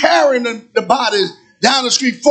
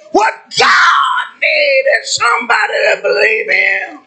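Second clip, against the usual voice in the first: high, shouted preaching cadence; impassioned Pentecostal shout-preaching; full-force preaching; 8 semitones higher than usual. Real, not acted. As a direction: drive each phrase home like a revival preacher.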